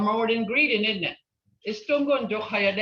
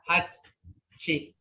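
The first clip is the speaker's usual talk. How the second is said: normal talking voice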